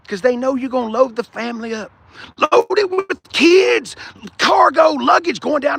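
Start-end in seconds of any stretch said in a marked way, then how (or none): 2.4-5.8 hammed-up exclaim. Mocking family road trip.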